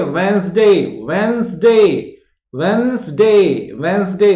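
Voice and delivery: "वेंसडे" loud and very drawn out, WENS-daay; loud two-syllable "वेंसडे", long falling end; pronunciation drill. Shown as a demonstration. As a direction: chant "वेंसडे" slowly for pupils to copy, d silent. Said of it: "मैं जैसा उच्चारण कर रहा हूं".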